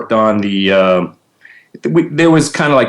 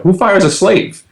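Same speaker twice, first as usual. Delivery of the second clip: high, slow incredulous question; drawn-out mock outrage; mock indignation; 11 semitones higher than usual. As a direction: protest the absurdity, pitched way up. Retelling his indignant reply to firing.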